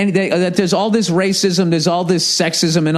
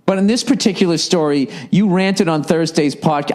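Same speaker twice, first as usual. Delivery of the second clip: usual speaking voice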